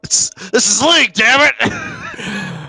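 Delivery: high, strained fake outburst; a hammed-up furious yell; mock angry Walter. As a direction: blow up like an uptight league bowler. Imitating Walter losing it.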